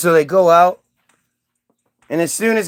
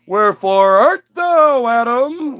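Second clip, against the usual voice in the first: God playfully hunting, higher and stretched; sing-song calling out; 5 semitones higher, slower than usual. Acted. God calling Adam like it's a little game, playful sing-song. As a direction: call out in a lilting mock-grand voice. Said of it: "he walks around going".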